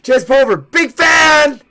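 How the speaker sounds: loud high call as they leave; shouted from afar. Retold or imitated. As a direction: yell it after him across the room. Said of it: "as they walk away".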